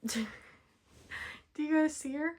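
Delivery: slow, laughing question to viewers. Real, not acted. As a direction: laugh as you ask.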